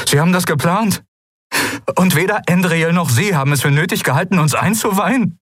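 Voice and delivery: high, strained indignation; barely controlled anger. A narrator's reading. Kai learns he was left out of the plan, barely controlled. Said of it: "Mühe, seine Stimme unter Kontrolle zu behalten".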